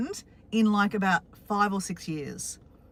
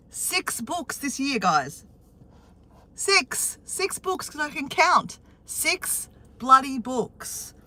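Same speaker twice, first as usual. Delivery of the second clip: excited; 4 semitones higher, slower than usual. Real, not acted. Excited bragging about books.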